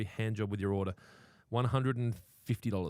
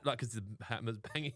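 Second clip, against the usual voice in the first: normal talking voice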